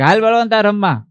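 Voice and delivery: high, loud call to a friend; calling out to play. Retold or imitated. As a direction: sing out to come and play.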